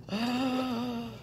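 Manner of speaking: soft gasp of surprise; real, hushed intake of breath; delighted gasp. Real, not acted. Unboxer discovering a third tray, soft surprised gasp.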